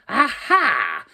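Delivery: high, loud scornful "Aha!"; spring the trap with a loud mocking cry; triumphant witch, high and loud. A narrator's reading. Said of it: "she cried scornfully".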